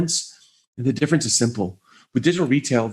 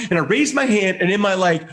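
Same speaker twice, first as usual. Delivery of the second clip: ordinary talk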